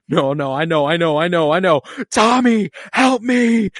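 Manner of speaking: pleading, then calling out to Tommy; imitating Joel's desperate plea. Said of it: "if Pedro Pascal isn't going".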